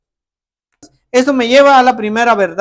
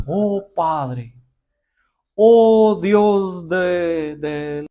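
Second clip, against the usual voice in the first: stiff formal prayer; 3 semitones lower, much slower than usual. Retold or imitated. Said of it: "la gente ora con respeto así".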